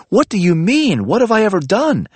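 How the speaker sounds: high, loud hurt protest; upset outburst. Acted. Libby confronting narrator about his breakup words, high hurt outburst. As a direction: fire it back, wounded and indignant.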